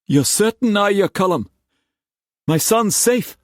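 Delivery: high, anxious questioning; desperate hope. Acted. Father making sure his son is safe, desperate. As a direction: plead for confirmation, voice high and urgent.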